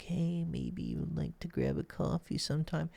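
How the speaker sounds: high, hesitant, meek coffee ask; timid ask. Shown as a demonstration. Coach mocking a timid way of asking a woman out, high and meek. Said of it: "It can be in general, like".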